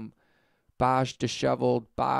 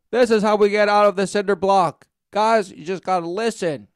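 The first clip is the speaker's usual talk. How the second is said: mock rallying call; 5 semitones higher, 7 dB louder than usual. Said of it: "And they're like".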